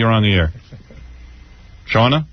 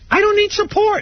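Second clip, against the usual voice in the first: pitched way up, insistent; emphatic high repeat. Real, not acted. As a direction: hammer it home, much higher. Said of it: "I tell you something".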